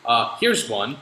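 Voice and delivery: ordinary talk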